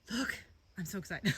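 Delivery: ordinary delivery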